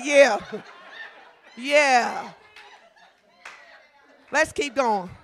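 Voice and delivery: loud, hammed-up praise team encouragement; praise team cheering. Praise team urging worship on, loud mock cheer. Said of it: "And the praise team say".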